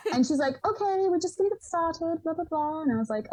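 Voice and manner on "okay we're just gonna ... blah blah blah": higher, imitated British accent, brisk and businesslike. British interviewer starting the Zoom interview, in an imitated British accent. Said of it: "she had this lovely British accent".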